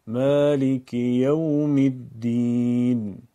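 slow, loud, sustained Arabic chant with long vowels; Arabic Quran recitation. Shown as a demonstration. Teacher recites the verse as example: slow Arabic recitation. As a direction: recite in Arabic, stretching مالک and الدین, "yawm" open. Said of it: "باید دقت کنیم اونو با لهجهٔ عربی بگیم".

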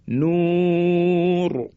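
"نور" with a long held waw and thick R; long vowel, heavy R. Shown as a demonstration. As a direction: hold the و of "نور", then a heavy ر. («نور» با واو کشیده و رای درشت)

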